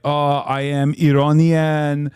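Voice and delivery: put-on thick Persian accent, slow and drawn out. Host showing off his Persian accent impression, heavy Persian accent. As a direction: lay on a heavy Iranian accent. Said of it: "I can do a pretty good Iranian".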